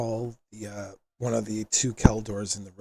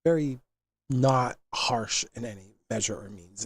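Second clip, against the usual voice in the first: ordinary delivery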